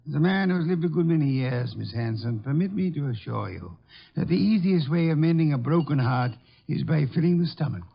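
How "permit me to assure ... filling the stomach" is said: ordinary talk, nothing marked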